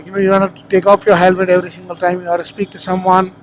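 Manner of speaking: ordinary talk, nothing marked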